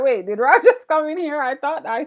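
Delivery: laughing retold surprise; laughing retelling. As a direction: retell your own surprise through a laugh. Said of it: "I was like".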